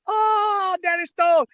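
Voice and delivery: high, loud, thrilled greeting; light up like you've spotted a celebrity; delighted recognition. Retold or imitated. Chris Flores spotting him a year later, high and delighted. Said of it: "Chris Flores goes".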